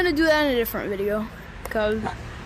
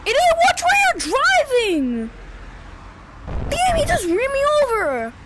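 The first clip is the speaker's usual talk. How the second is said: angry yelling; 12 semitones higher than usual. Real, not acted. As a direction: scream at the driver who ran you over. Yelling at driver in game.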